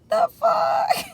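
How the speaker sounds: very high-pitched squawk of disbelief; retold shock. Patient told to sit on the bed, shocked squeal.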